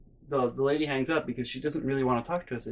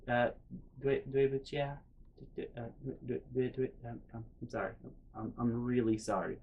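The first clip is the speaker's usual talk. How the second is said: soft, stammering imitation of apologizing; meek apology; 2 semitones lower, 9 dB quieter than usual. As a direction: shrink back and stumble through a sorry. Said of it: "I'm apologizing in Chinese".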